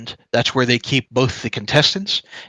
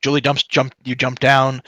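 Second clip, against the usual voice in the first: ordinary delivery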